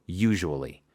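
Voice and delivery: usually said clearly. Shown as a demonstration. Teacher repeats usually for part three. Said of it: "parte tres".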